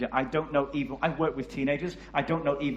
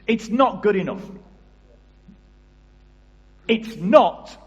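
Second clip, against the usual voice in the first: louder, higher, spaced out with pauses; preacher's emphatic declaration; rising emphasis; 6 semitones higher, 9 dB louder than usual. Real, not acted. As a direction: hammer it home with a long pause. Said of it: "I say it's not good enough".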